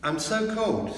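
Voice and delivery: put-on high, shivering child's voice; a cold little girl complaining. A narrator's reading. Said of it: "said Lucy".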